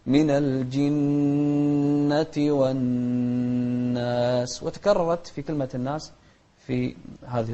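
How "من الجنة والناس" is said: very slow "من الجنة والناس", each doubled nun hummed long; nasalised double nun. Shown as a demonstration. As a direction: hold both nuns of "من الجنة والناس" in the nose. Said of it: "نغنها بمقدار حركتين، فنقول". (غنة ممدودة في نوني "من الجنة والناس")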